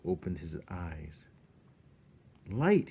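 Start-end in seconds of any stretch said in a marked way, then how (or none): 2.5-2.9 wondering. Acted. Despereaux sees light.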